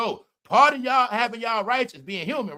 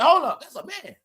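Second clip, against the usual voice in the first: mock double take; 8 semitones higher, 5 dB louder than usual. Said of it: "They just like".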